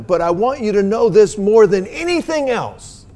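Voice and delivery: loud, rising fervent sermon voice; preaching at full force. Acted. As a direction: proclaim it to the congregation, building.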